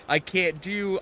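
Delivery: ordinary delivery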